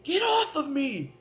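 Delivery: high, loud, slow protest; insistent denial. Retold or imitated. Shoving off family crowding him, high insistent protest. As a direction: push them off, voice way up. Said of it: "I'm like".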